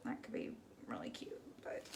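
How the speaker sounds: whispered to herself; whispered musing. Real, not acted. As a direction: murmur it under your breath, unvoiced. Musing over the painting.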